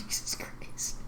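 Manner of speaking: laughing whisper. Real, not acted.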